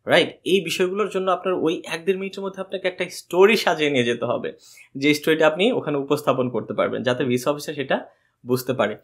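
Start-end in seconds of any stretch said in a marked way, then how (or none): none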